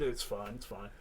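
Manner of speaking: low, very soft reassurance; muted brush-off. Retold or imitated. Comedian brushes off the apology.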